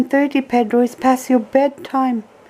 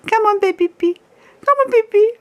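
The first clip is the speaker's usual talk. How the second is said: high coaxing; 12 semitones higher, faster than usual. Real, not acted. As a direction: lure the bird in a sweet high voice. Coaxing parrot to bed.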